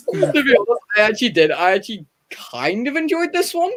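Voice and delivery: repeats it high through laughter; laughing. Real, not acted. Repeats own verdict while laughing.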